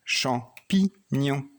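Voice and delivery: champignon drawn out very slowly, syllable by syllable; slow syllable drill. Shown as a demonstration. Teacher to child finding the sound, very slow champignon. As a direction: stretch champignon so the child hears the ni. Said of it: "Où est le son ni?".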